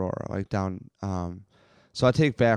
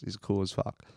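usual speaking voice

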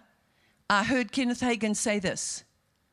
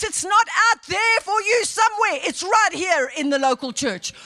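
high, loud exultant praise; fervent preaching; 8 semitones higher, 8 dB louder than usual. Real, not acted. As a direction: shout it out to the congregation. Preaching at full force.

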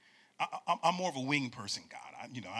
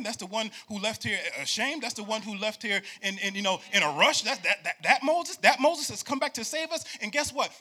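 rising, louder preacher cadence, almost singing; full-force preaching; 6 semitones higher, 6 dB louder than usual. Acted. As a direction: build the sermon to a shout.

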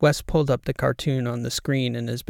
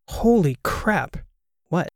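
slow, drawn-out disbelief with higher pitch; higher-pitched stunned exclamation; 5 semitones higher, slower than usual. Acted. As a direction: stretch it out, floored. Shocked at a huge donation.

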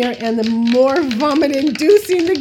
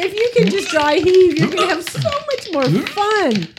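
higher, giggling through it; laughing; 9 semitones higher than usual. Real, not acted. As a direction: read it while giggling. Laughing while reading the joke.

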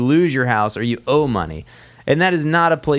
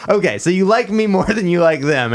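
higher-pitched playful grilling; mock pressing; 6 semitones higher than usual. Adviser asking a client to rank him against the IRS, mock pressing. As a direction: grill them in hammed-up, rising questions.

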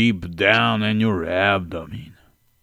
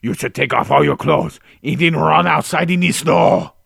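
loud and higher, hammed-up; mock character advice; 8 semitones higher, 6 dB louder than usual. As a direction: bark the silly advice. Character gives silly advice.

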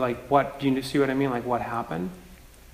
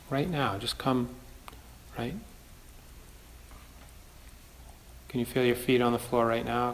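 calm grounding exercise; 3 dB quieter than usual. Acted. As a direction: gently guide them back to the body. Leads a grounding practice.

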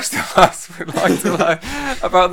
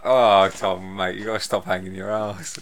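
lower, quieter, lightly imitating a friend; low mumbled mate voice; 8 semitones lower, 6 dB quieter than usual. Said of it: "He's go".

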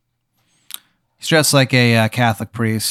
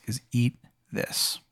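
hushed tempting offer; 12 dB quieter, slower than usual. Acted. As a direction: drop the volume and draw it out. Sugar King offers the candy.

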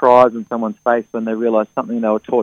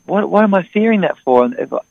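higher-pitched, questioning disbelief; incredulous, lifted self-questioning; voiced realisation; 7 semitones higher than usual. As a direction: act out someone suddenly questioning their fears. Acts out fearful people's realisation.

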